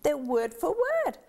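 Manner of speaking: put-on child amazed the two Bibles match.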